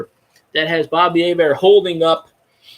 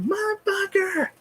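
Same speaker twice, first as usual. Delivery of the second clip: high, slow strained curse; mock scream; 6 dB quieter, slower than usual. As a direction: stretch the swear out like a held-back scream. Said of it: "he's out there screaming".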